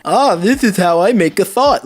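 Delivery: mock dimwit voice. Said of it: "put buzzwords together and think that".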